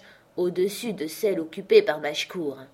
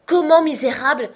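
much higher, louder scolding cry; grandmother outraged; 9 semitones higher, 10 dB louder than usual. A narrator's reading. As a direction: tear into the wretch. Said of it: "acheva ma grand-mère". (grand-mère indignée qui crie)

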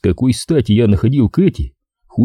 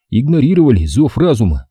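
plain reading voice

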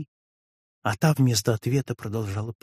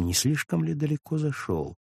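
usual speaking voice

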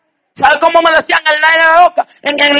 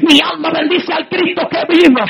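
higher, rising preacher's cry, shouting praise; fiery preaching; 4 semitones higher, 4 dB quieter than usual. Real, not acted. Preacher testifying to the congregation, full-force preaching. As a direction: preach it out with building fervor.

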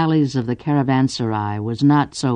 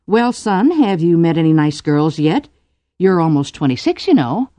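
higher, prodding motherly voice; playing Ku's ma; 5 semitones higher, 4 dB louder than usual. Cordelia plays Ku's ma to her son, motherly. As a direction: nag a grown son as his ma. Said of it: "being Ku's ma".

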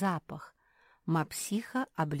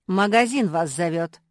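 loud announcement; 11 dB louder than usual. A narrator's reading. Malum announcing loudly.

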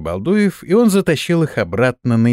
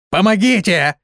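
high-pitched urgent call; cry for help; 8 semitones higher than usual. A narrator's reading. Голос из камня зовёт на помощь. (высокий зов о помощи)

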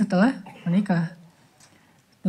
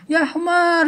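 high imitated name-calling; rude mock insult; 9 semitones higher, much slower than usual. Egyptian passenger calling another a donkey, mock rude. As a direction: hurl a playful mock curse. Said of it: "ngomongnya kasar".

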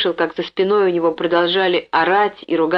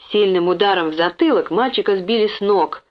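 usual speaking voice

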